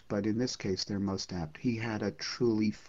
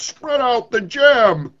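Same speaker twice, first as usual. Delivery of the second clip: sun's command; 13 semitones higher, 13 dB louder, slower than usual. Acted. The sun ordering the poet to set out jam, slow booming command. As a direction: spread out the jam, slow and much louder. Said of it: "he spoke deep bass".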